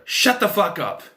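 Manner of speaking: exasperated yell at a movie character; retold yell. Teen yelling at Heather on the TV, exasperated yell. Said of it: "I kept yelling at the TV".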